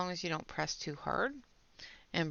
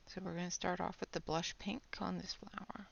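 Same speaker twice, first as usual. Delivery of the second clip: whispering; 7 dB quieter than usual. Real, not acted. Whispers while coloring on stream.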